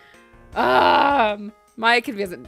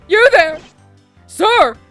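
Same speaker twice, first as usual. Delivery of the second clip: loud, higher call; 6 semitones higher, 8 dB louder, slower than usual. Acted. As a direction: call across the room. Calling out as officer.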